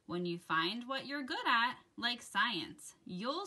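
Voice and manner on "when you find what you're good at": usual speaking voice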